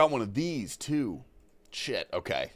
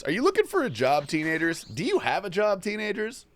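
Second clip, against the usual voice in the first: mock pitch; 8 semitones higher than usual. Mock job ad to teenagers.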